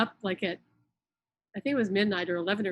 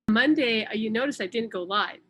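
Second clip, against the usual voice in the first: ordinary delivery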